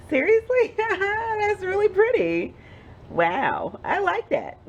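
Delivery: high, slow amazed squeal with a laugh; delighted surprise. Real, not acted. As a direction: gasp it out, thrilled. Surprised by how soap looks.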